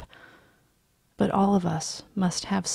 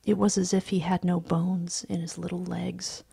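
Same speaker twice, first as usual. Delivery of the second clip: ordinary delivery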